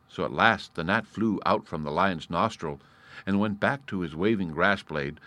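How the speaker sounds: ordinary delivery, nothing marked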